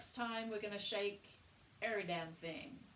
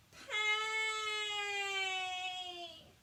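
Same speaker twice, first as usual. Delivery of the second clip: much higher pay, ringing; 9 semitones higher than usual. Shown as a demonstration. Voice coach showing mask resonance, high ringing pay. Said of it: "talk in that voice for a bit".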